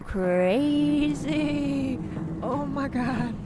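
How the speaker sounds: ordinary talk, nothing marked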